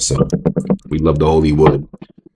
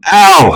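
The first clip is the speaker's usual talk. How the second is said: mock yelp; 6 dB louder than usual. Sudden mock pain cry.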